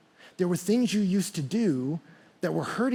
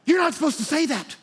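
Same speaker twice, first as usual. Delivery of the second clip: shocked disbelief; 8 semitones higher than usual. Retold or imitated. Listener reacting to [name], high shocked disbelief. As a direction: react as if someone said something scandalous. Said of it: "And you're like".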